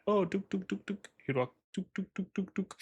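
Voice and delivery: high dooking ferret noises. Retold or imitated. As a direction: bounce out dook dook sounds playfully. Imitating a silly walking gait.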